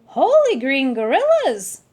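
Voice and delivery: loud, shocked cry; shouted surprise; startled exclamation. Acted. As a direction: blurt it out in alarm.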